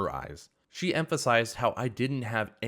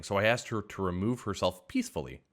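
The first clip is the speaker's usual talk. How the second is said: usual speaking voice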